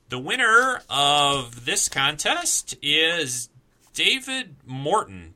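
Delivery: high, slow dramatic reveal; grand announcer reveal. Acted. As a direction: draw out the winner's name. Drum roll winner announcement.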